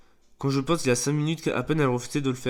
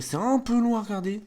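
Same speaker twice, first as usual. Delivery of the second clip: "un peu long" pitched up and stretched; 8 semitones higher than usual. Real, not acted. Player telling viewers why the video was cut, "un peu long" stretched high. Insiste sur la longueur.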